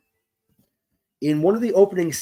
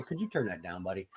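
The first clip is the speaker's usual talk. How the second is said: much softer, low aside; quiet aside; 7 semitones lower, 14 dB quieter, faster than usual. Real, not acted. Host quietly asks someone nearby to turn it down. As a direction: murmur the request off-mic.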